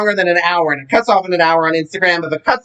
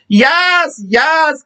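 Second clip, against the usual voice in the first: excited shout; 7 semitones higher than usual. Real, not acted. Cheering at parents' glamour photos.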